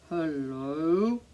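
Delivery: hello dropped deep and low. Shown as a demonstration. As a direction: sink your voice right down for hello. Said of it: "as low as we can".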